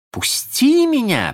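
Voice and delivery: громко и высоко, медленно; потребовать, чтобы отпустил; приказ. Acted. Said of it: "приказала она". (Венди приказывает Питеру отпустить её, громко)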